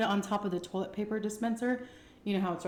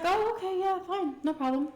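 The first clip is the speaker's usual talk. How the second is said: much higher, light, carefree mom voice; breezy mom; 11 semitones higher, 3 dB louder than usual. Retold or imitated. Mom okaying the trip to Decatur, a much higher, breezy yes. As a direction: chirp it like an unsuspecting mom. Mom cheerfully agrees, unsuspecting.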